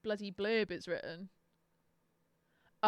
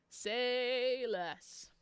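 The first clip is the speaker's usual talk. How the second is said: a lingering, stretched-out "Say less"; drawn-out emphasis; 3 semitones higher, 3 dB louder than usual. Real, not acted. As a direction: SAY stretched long, higher than usual. Same words repeated drawn out.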